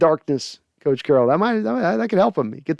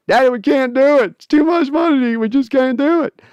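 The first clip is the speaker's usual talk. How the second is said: high whiny plea to daddy, a bit louder; pleading daughter; 10 semitones higher, 4 dB louder than usual. Acted. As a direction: beg your father, voice pitched way up. Daughter voiced pleading with father.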